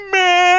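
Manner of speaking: long, high, held honk; car horn imitation. Driver honking at woman holding up the line, blaring. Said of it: "put my hand on my horn".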